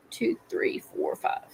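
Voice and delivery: counting under her breath; whispered counting. Real, not acted. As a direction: whisper the numbers to yourself. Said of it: "let's see".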